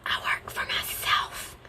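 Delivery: whispered imitation of friend; deadpan punchline. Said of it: "she would go".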